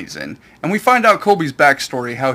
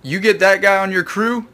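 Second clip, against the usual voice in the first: a bit louder, urgent pleading; Coby panicking; 4 semitones higher, 6 dB louder than usual. Retold or imitated. Coby warning Luffy about Zoro, louder, urgent alarm. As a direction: warn him off, alarmed. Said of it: "Kobe's like".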